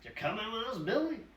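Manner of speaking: put-on the old drifter inviting Billy along; Doc asking. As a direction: ask him, hopeful. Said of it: "Doc puts out the fire with dirt and says".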